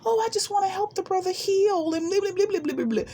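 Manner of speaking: high, sing-song mock of her earnest old self; airy high parody of naive sincerity. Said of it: "I'm not making fun of myself".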